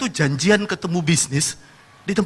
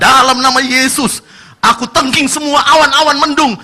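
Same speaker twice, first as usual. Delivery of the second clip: fervent mock prayer; 3 semitones higher, 5 dB louder than usual. Ice seller praying loudly to chase away rain clouds. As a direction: pray hard, then rebuke the clouds.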